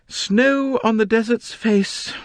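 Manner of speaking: hammed-up authorial pride; grandly announced title. A narrator's reading. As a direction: proclaim the title with relish.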